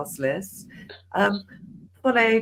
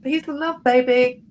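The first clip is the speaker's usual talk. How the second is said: breezy peace and love; 5 semitones higher, 5 dB louder than usual. Retold or imitated. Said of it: "turned around and went".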